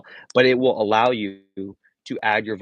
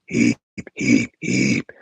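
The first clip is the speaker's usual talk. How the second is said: lower, breathy inward bass hits; unvocalized inward chest bass; 9 semitones lower, 4 dB louder, much slower than usual. Shown as a demonstration. Teacher showing students the basic inward chest bass. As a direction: pull the bass inward on each heep. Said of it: "Just do regular unvocalized inward chest bass".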